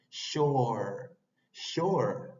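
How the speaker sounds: shore with lips pushed forward on an open o; rounded shore. Shown as a demonstration. Teacher modelling shore with rounded lips. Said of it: "Por eso se dice shore".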